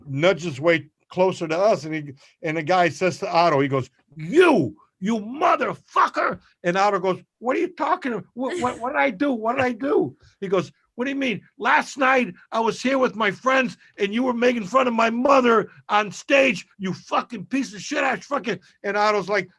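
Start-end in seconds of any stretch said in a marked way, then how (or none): 4.2-6.4 higher-pitched mock-furious accusation, words drawn out; retold outraged holler; angry confrontation. Retelling deranged guy confronting Otto. 7.4-10.2 high-pitched, stammered retold bewilderment; bewildered protest. Otto startled by accuser, retold. 10.9-18.6 high-pitched retold tirade; mock-furious accusation; angry rant. Retelling the guy's angry rant.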